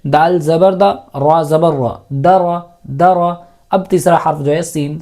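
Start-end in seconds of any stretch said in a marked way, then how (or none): 0.0-3.3 drawn-out syllable-by-syllable spelling, دال زبر دا; slow, careful teacher's spelling drill; slow spelling demo. Shown as a demonstration. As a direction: spell each letter slowly, then join دا را. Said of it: "میں مثال دیتا ہوں".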